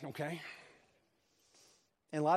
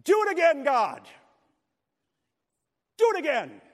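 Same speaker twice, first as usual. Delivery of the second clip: high, booming yell to God; imitated yell; 9 semitones higher, 9 dB louder than usual. Preacher imitating a congregant crying out to God, loud yell. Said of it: "He would yell out".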